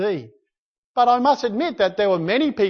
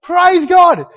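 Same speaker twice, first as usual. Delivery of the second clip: loud, high, ecstatic cries of praise; joyful praise shout; 9 semitones higher, 8 dB louder than usual. Retold or imitated. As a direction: shout praise to God over the storm. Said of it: "They're going".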